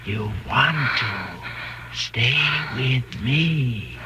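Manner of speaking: very slow, deliberate; coaxing, eerie calm; hypnotic persuasion. Acted. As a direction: lull her into staying word by word. Husband hypnotizing wife.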